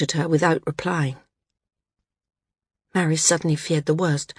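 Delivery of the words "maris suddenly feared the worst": usual speaking voice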